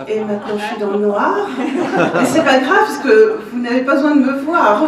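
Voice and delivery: laughing out loud through the words. Real, not acted. Laughing about speaking in dark.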